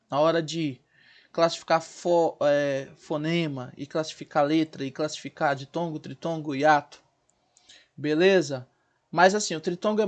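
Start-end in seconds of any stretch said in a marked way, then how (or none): none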